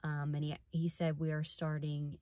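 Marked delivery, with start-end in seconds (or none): none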